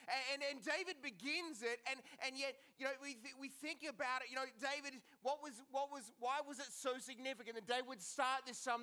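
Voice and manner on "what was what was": normal talking voice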